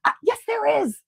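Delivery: high-pitched insistent comeback; hammed-up high retort; retold retort. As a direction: act out an exasperated contradiction, up high. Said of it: "And I'm like".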